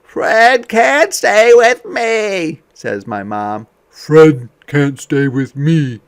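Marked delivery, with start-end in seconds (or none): 0.0-2.6 much higher, louder, slow and emphatic; firm mom. Acted. Mom voiced in story. 3.9-6.0 louder, stern father; dad refusing to keep the dog; firm dad. Acted. Dad voiced in story.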